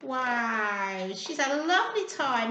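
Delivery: ordinary delivery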